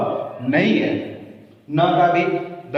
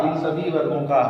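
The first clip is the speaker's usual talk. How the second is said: normal talking voice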